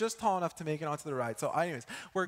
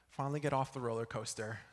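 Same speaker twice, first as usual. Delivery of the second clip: normal talking voice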